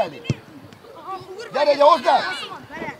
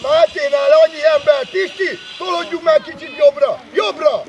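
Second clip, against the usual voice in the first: loud, raised sideline calling; urgent shouted orders; 3 semitones higher, 4 dB louder than usual. Real, not acted. Coach moves players right, urgent shouting. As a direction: holler directions across the pitch.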